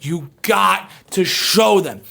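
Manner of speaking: normal talking voice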